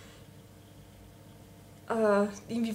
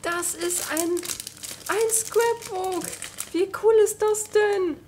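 high, loud, slow delight; excited; 7 semitones higher, 6 dB louder than usual. Real, not acted. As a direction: gush with excitement. Delighted by the scrapbook.

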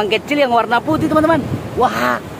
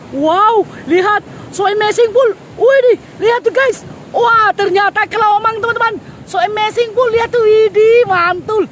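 much higher, squealing with delight at the find; thrilled squeal; 12 semitones higher than usual. Real, not acted. Vlogger shows viewers the hermit crab, high delighted squeal.

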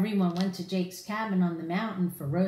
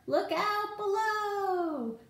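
much higher, slow drawn-out call; playful warning call; 12 semitones higher, much slower than usual. Acted. Jake and Everest sliding downhill, playful drawn-out call. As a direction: sing out the warning while sliding.

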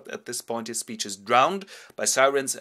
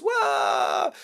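very high startled yelp; startled shriek; 6 dB louder than usual. Acted. Mrs Biedermann shrieking at the fake ghost. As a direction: shriek in fright. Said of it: "And Missus Biedermann goes".

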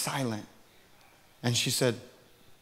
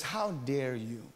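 slow, much softer stern rebuke; a hushed, icy accusation; stern how dare you; 2 semitones lower, 11 dB quieter, much slower than usual. Retold or imitated. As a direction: clerk confronting him quietly, stern. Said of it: "and she says".

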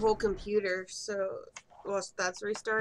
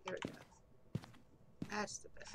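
whispering; 16 dB quieter, much faster than usual. Real, not acted. Whispering while ghost hunting.

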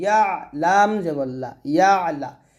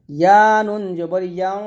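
spelling drill; 3 dB louder than usual. Shown as a demonstration. Teacher spelling Arabic syllables aloud for students, sing-song drill. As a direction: chant each letter and vowel mark for the class.

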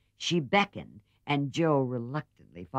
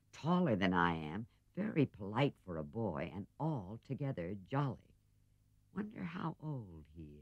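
musing quietly; 2 semitones lower, 8 dB quieter than usual. Acted. Jo musing to herself, trailing off.

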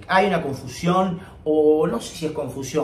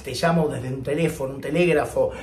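normal talking voice

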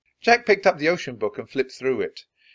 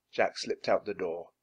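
ordinary delivery, nothing marked

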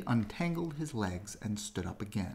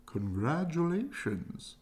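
slow, higher owlish congratulations; a stately old owl greeting; owl's voice; 8 semitones higher, slower than usual. A narrator's reading. Owl congratulating the doe, slow higher voice. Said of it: "said the owl to the doe".

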